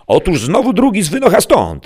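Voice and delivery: high, loud exasperated outburst; exclaiming. A narrator's reading. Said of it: "zawołał Sancho". (głośny, zniecierpliwiony okrzyk)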